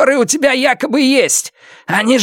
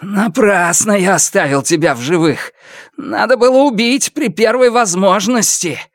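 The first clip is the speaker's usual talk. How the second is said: raised, effortful bitter voice; labouring for breath; 9 semitones higher than usual. A narrator's reading. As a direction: push each word out on a heavy breath. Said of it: "Каждое его слово сопровождалось тяжёлым вдохом". (с тяжёлым вдохом на каждом слове)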